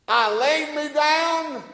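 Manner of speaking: high, loud, drawn-out verse reading; declaimed scripture. Real, not acted. Preacher reading a psalm to the congregation, declaiming it high and slow.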